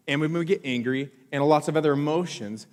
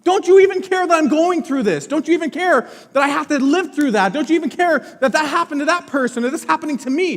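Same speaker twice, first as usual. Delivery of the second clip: higher, louder pleading outcry; desperate plea to God; 9 semitones higher, 6 dB louder than usual. Retold or imitated. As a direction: cry out to God, feeling abandoned. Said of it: "I bet you you've gone".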